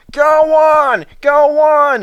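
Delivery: high, loud screaming plea; van begging them to stop; screamed. Acted. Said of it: "it screamed".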